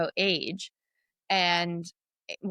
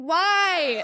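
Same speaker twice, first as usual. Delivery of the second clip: surprised; 11 semitones higher, 5 dB louder than usual. Retold or imitated. Retold surprised question.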